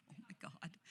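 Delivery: breathy aside under her breath; exasperated whisper. Retold or imitated. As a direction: whisper it in disbelief. Her inner reaction, whispered.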